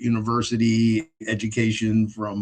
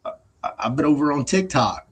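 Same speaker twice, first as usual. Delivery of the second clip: telling the narrator about TikTok earnings, excited and high; friend's voice; 5 semitones higher than usual. Retold or imitated. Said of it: "he goes".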